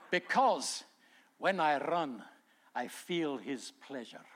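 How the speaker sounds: imitating a Scottish runner's accent; lightly put-on Scottish accent. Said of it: "he said it with a Scottish accent".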